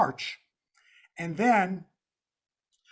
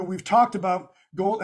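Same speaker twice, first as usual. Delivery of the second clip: ordinary talk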